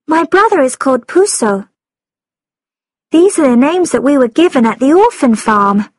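put-on high, polite little girl; young girl voice. A narrator's reading. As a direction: speak as a shy polite girl. Girl character voice.

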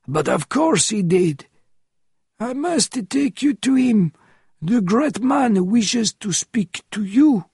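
shaking voice. A narrator's reading. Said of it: "replied in a shaking voice".

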